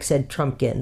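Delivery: ordinary delivery, nothing marked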